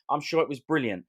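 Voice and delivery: usual speaking voice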